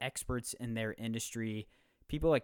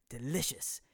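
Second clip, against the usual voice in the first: throat cleared, then a hint of a British accent; a playful try at a British celebrity chef's accent; Gordon Ramsay accent. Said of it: "that's my Gordon Ramsay".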